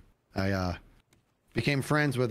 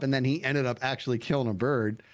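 ordinary talk

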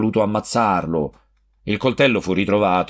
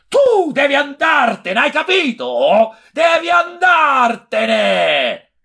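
much higher, louder, slow and drawn out; furious shout; 4 dB louder, slower than usual. A narrator's reading. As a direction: scream it with the knife in hand. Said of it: "feci per menare gridando". (urlo furioso, molto acuto e lento)

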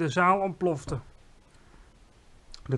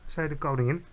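ordinary delivery, nothing marked

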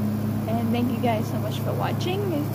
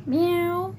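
higher playful meow; imitated cat meow; 4 semitones higher than usual.

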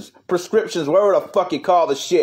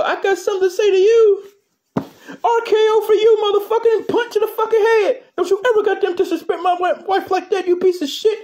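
high pitched puppet yelling threats; squeaky little brawler going off; high puppet wrestler; 12 semitones higher than usual. Acted. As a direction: puppet defending his wife in a high voice. High puppet voice attacks magician.

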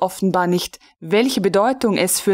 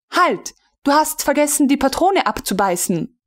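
calling out; 6 semitones higher than usual. A narrator's reading. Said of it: "rief Frau von Melin".

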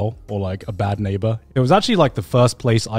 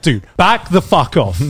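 slow, firm "back off"; firm warning; 3 semitones higher than usual. Retold or imitated. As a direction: draw a line, slowly and firmly. Facing down the raging neighbor.

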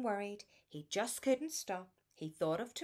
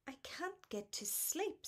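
sadly; 8 semitones higher, 4 dB quieter than usual. A narrator's reading. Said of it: "said Harry sadly".

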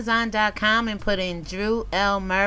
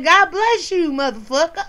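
sassy mock blessing; 7 semitones higher, 5 dB louder than usual. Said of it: "And I said".

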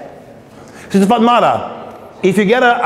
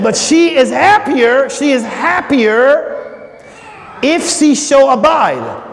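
slow, drawn-out and higher; Paul quoted with emphasis; 5 semitones higher, much slower than usual. Acted. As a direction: savor each word slowly. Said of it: "But he said".

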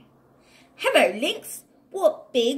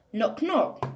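ordinary delivery

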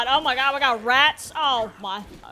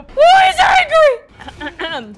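a drawn-out bellow at the enemy; slow shout; 13 semitones higher, 11 dB louder, slower than usual. Real, not acted. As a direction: shout it slowly, much higher and much louder. Yelling at enemies while fighting.

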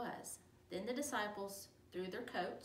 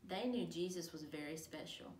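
usual speaking voice

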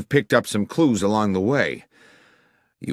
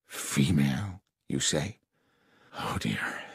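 low, hushed dismay; a little shaken; 3 semitones lower, 7 dB quieter than usual. A narrator's reading. Masterson learning of a concealed woman, a little shaken. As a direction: falter softly, sinking at the end. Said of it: "Masterson appeared a little shaken".

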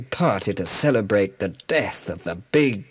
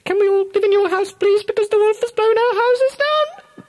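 very high, fast squeaky piglet plea; pleading little pigs; 5 dB louder than usual. Acted. Two pigs begging the third pig for shelter, very high squeaky piglet voices. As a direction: beg in tiny pig voices. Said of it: "they cried".

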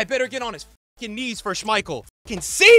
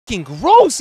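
ordinary talk

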